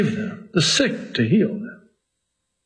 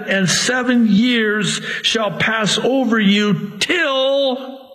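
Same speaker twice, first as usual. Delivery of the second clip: raised, slowed Bible reading; scripture reading; 5 semitones higher than usual. Acted. Preacher reading the judgment on the king, declaiming.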